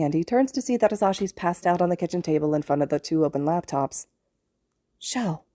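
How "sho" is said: soft hushed call; whispering. A narrator's reading. Boy hushing his friend beside a sleeping teacher, whispered. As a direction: hush so the sleeping hero won't wake. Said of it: "Izuku whispers".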